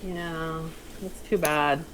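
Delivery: ordinary talk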